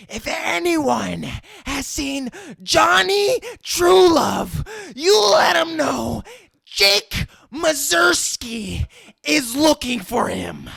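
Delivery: roaring, slow and high threat; raging shout. Retold or imitated. Furious gangster bellowing at a silent party. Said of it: "he just gets in this rage".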